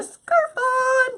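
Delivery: high, drawn-out, belted announcement; annoying showy holler. Acted. Host teasing the boys with the finished scarf, over-the-top high holler. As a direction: sing it out big and stretch the ending. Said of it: "it's annoying".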